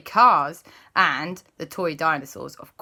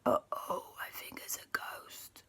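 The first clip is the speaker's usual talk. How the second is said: frightened breathy whisper; scared whisper; 13 dB quieter than usual. Acted. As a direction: whisper as if afraid to be heard. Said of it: "everyone went".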